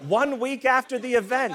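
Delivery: normal talking voice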